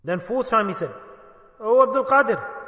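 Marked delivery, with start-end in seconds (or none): 1.6-2.5 a raised call to the shaykh, falling at the end. Retold or imitated. An alcoholic calling out to the saint, a raised call. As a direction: call his name across the room.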